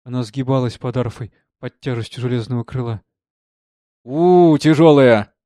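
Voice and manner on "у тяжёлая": loud exclamation of relief from a burden; audibly catching breath. A narrator's reading. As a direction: catch your breath under a heavy load. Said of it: "слышно передохнула орфянка". (громко переводя дух)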